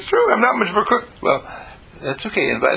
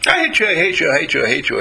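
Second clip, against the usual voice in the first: high, emphatic start that drops away; hateful outburst; 5 semitones higher, 4 dB louder, faster than usual. Acted. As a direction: replay the hateful rant, letting it sink.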